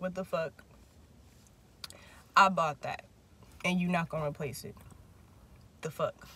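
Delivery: low, soft, unamused mom imitation; spaced-out, under-the-breath acted disbelief; retold quiet indignation. Voicing mom over the finished Jameson, quietly indignant.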